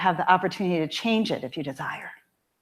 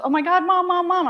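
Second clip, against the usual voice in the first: high, frantic mock daughter calling for mom; panicked daughter; 9 semitones higher, 5 dB louder, faster than usual. Daughter phoning her mom in a panic, frantic and high. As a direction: play the panicked teen on the phone. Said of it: "she's really upset".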